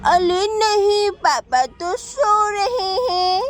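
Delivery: ordinary talk, nothing marked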